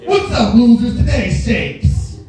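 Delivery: loud, slow macho bro talking down; Andrew Tate impression. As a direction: sneer at the baby like a tough influencer. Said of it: "You don't Andrew Tate a baby".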